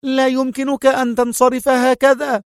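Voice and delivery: high woman's voice; frightened protest. A narrator's reading. Nurse calls after leaving patient, high and frightened. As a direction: call after him in fright. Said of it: "صاحت به الممرضة مذعورة".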